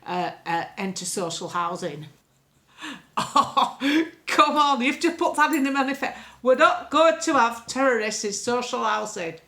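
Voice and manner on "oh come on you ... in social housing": loud, high put-on indignation; hammed-up outrage at the manifesto; mock exasperated politician.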